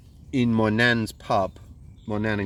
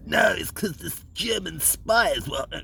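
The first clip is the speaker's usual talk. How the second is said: mocking a conspiracy believer; 9 semitones higher than usual. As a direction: parody the gullible cheerleader, lightly. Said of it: "what you get is the cheerleader".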